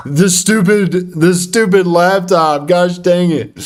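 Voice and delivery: exasperated grumble at a misbehaving laptop; frustrated outburst. Real, not acted. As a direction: groan it at the laptop. Frustrated at laptop pre-move.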